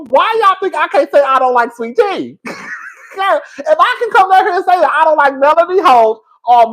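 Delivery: high, loud and laughing; half-shouting, egging the audience on; a worked-up defiant rant. Real, not acted.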